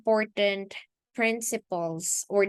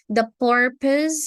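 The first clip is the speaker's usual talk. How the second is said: purpose said louder and higher; stress on purpose; 4 semitones higher, 6 dB louder than usual. Real, not acted. Teacher stressing key word.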